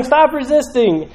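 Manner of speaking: high-pitched mock shout; shouted police order. Shown as a demonstration. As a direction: call out the order for bystanders to hear. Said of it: "So you're shouting".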